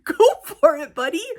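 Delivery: teacher cheering a pupil's idea; cheery encouragement. Retold or imitated. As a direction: cheer the kid on. Cheering on a student.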